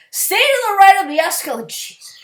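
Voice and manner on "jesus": a voiceless, weary curse; exasperated whisper. Real, not acted. Ranting about escalator etiquette, mutters a whispered curse. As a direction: breathe it out under your breath, fed up. Exasperated aside after rant.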